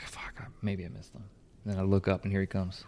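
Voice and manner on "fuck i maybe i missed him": very soft whispered self-talk; retold hushed, disappointed thought; whispered worry. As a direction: whisper a doubt to yourself. Retold worried thought while waiting.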